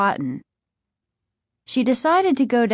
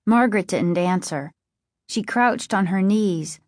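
ordinary delivery

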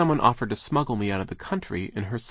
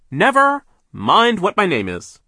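loud, high, drawn-out boss voice; big overbearing mill owner; brusque boss; 11 semitones higher, 12 dB louder, slower than usual. A narrator's reading. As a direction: boom it out slowly and impatiently. Mill owner brushes off question.

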